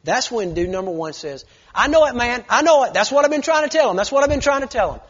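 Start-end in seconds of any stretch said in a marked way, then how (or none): 1.7-5.0 loud, higher, insistent; emphatic agreement. Retold or imitated. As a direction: drive it home. Young man agreeing emphatically.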